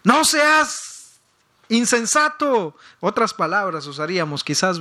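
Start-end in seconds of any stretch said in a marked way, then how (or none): 0.0-2.8 mock shout at child. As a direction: yell after your child to stop. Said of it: "y le grita".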